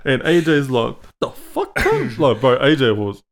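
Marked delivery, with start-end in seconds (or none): 1.2-2.2 high-pitched mock outrage; retold indignant disbelief. Mate snapping back at the old man's insult, mock indignant. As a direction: imitate an affronted mate, pitched right up. Acts out mate's offended reply.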